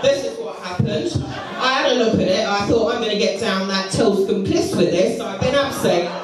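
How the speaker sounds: ordinary talk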